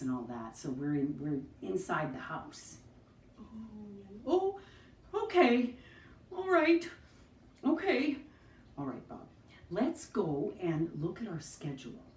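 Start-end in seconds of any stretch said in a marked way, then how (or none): 4.3-8.2 high puppet voice. Acted. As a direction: chirp it as the puppet. Bob puppet voice.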